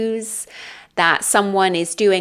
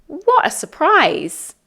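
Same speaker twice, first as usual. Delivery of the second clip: much higher, delighted exclamation of surprise; 8 semitones higher, 3 dB louder than usual. Acted. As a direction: act out bumping into a friend unexpectedly. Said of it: "if I see a British friend".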